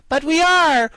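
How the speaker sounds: very high, loud foot-stamping insistence; impatient. Acted. Ginger insists to the doubting guardian, high impatient cry. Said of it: "stamping her foot impatiently".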